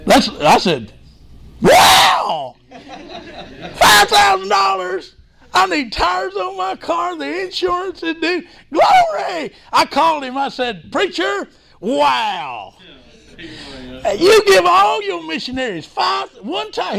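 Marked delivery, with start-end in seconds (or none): 1.6-9.5 high, loud, amazed shouts with a laugh; overjoyed shout, pitched up high; excited exclaiming. Retold or imitated. Retelling joy at a surprise gift. 10.9-17.0 high, slow, marvelling question; pitched-up astonished asking; amazed question. Retold or imitated. Asking preacher about the gift.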